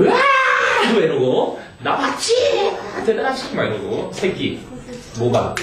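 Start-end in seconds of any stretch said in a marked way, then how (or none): 0.0-0.9 high shrieking yelp; screaming while falling. Retold or imitated. Retold chimp falling scream. 1.8-3.5 high boastful chimp voice; boasting chimp. Retold or imitated.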